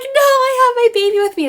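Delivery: high, tearful pleading wail; retold bawling plea. Mother pleading with courthouse staff, high tearful wail. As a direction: replay the panicked crying plea. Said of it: "bawling, causing a scene".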